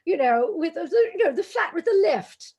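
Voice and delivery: high, put-on British accent, hammed up; mock British accent about the flat; mock posh English. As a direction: parody a posh English speaker. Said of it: "I did not come back saying".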